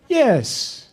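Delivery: high, emphatic drawn-out yes; imitating Bernardo. Director confirming to Keanu, high emphatic imitation. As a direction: mimic the director's blithe confirmation. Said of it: "Really, Bernardo?".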